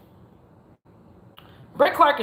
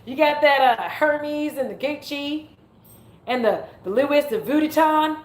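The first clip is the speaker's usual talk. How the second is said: mock Southern accent; 6 semitones higher, 3 dB louder than usual. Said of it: "my dumb ass, be like".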